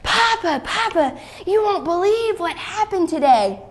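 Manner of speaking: put-on high-pitched, eager little boy; excited child just run home; breathless excitement. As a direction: call to Papa, bursting with news. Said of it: "he was out of breath".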